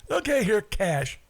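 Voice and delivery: high-pitched breezy buyer, easing down; mock big spender paying up; carefree rich buyer. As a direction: toss it off like money is nothing.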